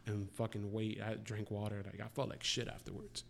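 normal talking voice

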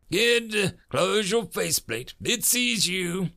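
high, urgent snap; snapped order. A narrator's reading. Major warning the kid that it sees him, snapping. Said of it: "the major snapped".

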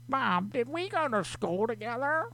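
put-on high, eager "old friend" voice; fake familiar caller. Shown as a demonstration. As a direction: squeak it up like a long-lost classmate. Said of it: "Then I can be like".

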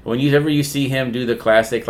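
normal talking voice

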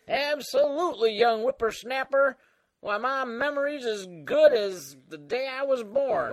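put-on creaky old-timer voice; Old Man Dan. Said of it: "uh, Old Man Dan?".

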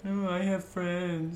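mock whine about friends; whiny voice. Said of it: "that whiny voice that you hear".

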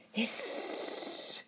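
loud, long sizzling hiss; snake-like hiss. A narrator's reading. Fire going out under the elephant's water, long snake-like hiss. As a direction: hiss loudly like doused fire. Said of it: "went the fire like a snake".

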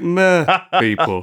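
drawn-out meh. Retold or imitated. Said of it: "they're like".

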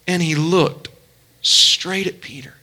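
normal talking voice

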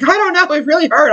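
high-pitched mock complaint; retelling a woman's pained phone call; imitated hurt woman. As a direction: put on a high voice, whining about pain. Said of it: "She's like".

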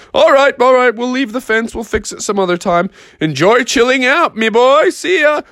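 high, hearty bull farewell; agreeable bull. Acted. Benny the Bull leaves Froggy to chill, agreeable. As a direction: call it out high to a pal.

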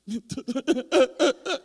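stuttering, breathy mock sobs; mock sobbing. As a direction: break into hammed-up staccato sobbing. Acts out breaking down during grace. (sollozos entrecortados exagerados)

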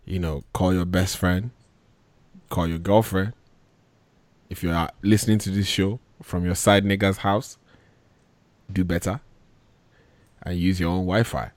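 usual speaking voice

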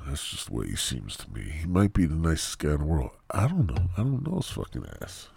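normal talking voice

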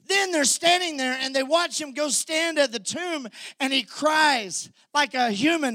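high, loud, building preacher's cry; preaching at full force. Real, not acted. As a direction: let the wonder build into a shout.

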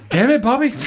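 high, loud Texan twang; Hank Hill impression. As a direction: yell like an exasperated cartoon dad. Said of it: "What if Hank Hill just walked out".

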